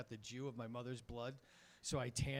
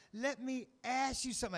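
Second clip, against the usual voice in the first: put-on much higher child voice, proud; kid voice; 10 semitones higher, 4 dB louder than usual. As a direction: deliver the joke like a small boy, higher. Kid delivers joke.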